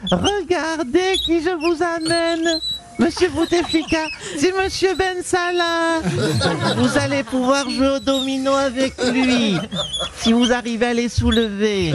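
put-on old lady carer. Presenting two old men.

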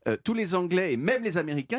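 normal talking voice

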